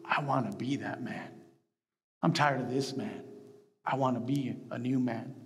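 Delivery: low, subdued tearful confession, retold. Recalling his own turning point to the congregation, mildly tearful. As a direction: retell it quietly, holding back tears. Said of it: "With tears in my eyes".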